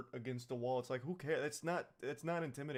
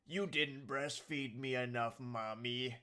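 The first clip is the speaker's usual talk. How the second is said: lightly faked goofy demon voice; slow, mock-creepy kid demon; cliche demon voice; slower than usual. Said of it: "the very cliche horror movie demon voice".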